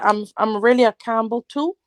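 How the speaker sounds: ordinary delivery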